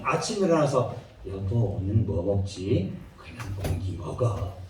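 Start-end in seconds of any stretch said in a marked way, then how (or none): 1.3-2.9 much lower, slow husband voice; lightly voiced poor husband asking what to eat; husband asking wife. 가난한 부부의 아침 대화. 3.2-4.6 much lower, breathy whispered answer; hushed reply. Pretend. 아내의 대답.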